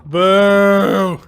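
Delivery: drawn-out crowd-style jeer; jeering boo. Real, not acted. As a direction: boo the bad joke like a heckler. Jeering at a friend's joke.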